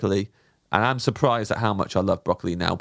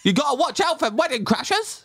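playful high voice; 14 semitones higher than usual. Real, not acted. Joking about wedding crashers.

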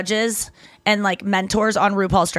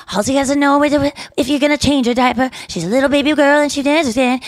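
put-on baby talk; 4 semitones higher than usual. Explaining the song to will.i.am, lighter baby talk. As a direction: keep the baby act going. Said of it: "What's with the baby talk?".

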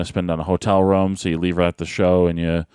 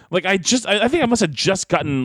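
ordinary talk

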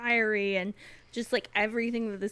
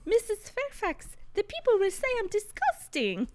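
put-on much higher, innocent child's voice; a naive little girl telling on people; 12 semitones higher than usual. Imitating Adele telling Mrs. Fairfax, in a much higher, innocent child voice. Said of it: "so she's like".

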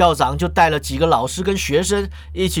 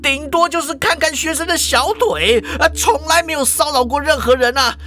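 high-pitched furious denial; desperate indignant outburst, voice pitched way up; frantic, worked up; 8 semitones higher than usual. Acted. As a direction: protest hotly, climbing into a tirade. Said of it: "校长就急了".

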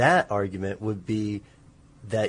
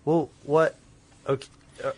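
halting false starts, tripping over the questions; start, stop and restart, flustered; stuttering; 5 semitones higher than usual. Real, not acted. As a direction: stammer as if several questions crowd in at once. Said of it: "I'm stuttering because I'm trying to ask".

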